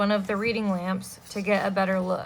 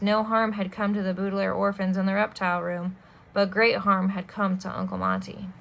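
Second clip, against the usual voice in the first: usual speaking voice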